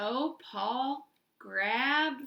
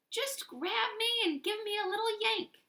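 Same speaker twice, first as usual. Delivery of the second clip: very high coaxing voice; coaxing pigtail; 11 semitones higher than usual. A narrator's reading. Pigtail urging Paul to yank it, very high. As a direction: coax him like a tiny tempter.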